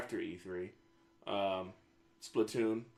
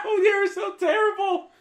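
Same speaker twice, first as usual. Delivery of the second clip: much higher, louder laughing squeal; laughing shriek; 8 dB louder than usual. Real, not acted. Friend shrieks with laughter at the ghost joke. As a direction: squeal in shocked delight.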